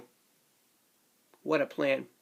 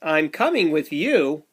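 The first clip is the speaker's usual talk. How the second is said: put-on lively read-aloud for little kids; sing-song storybook rhythm; bouncy picture book narration; reading to children; 5 semitones higher, 7 dB louder, much slower than usual.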